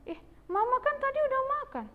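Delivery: high, fast protest; indignant disbelief. Acted. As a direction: object like a child catching out a fib. Sibling disputes the excuse.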